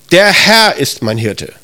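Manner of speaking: "Herr" drawn out and prominent, slow delivery; stress on HERR. Shown as a demonstration. Preacher stresses who the shepherd is, slow weight on "Herr". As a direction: slowly point out "Herr" as the one who matters. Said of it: "Da betonst du, das ist der".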